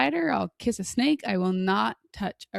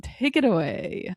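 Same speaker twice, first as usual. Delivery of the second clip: usual speaking voice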